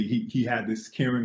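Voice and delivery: ordinary talk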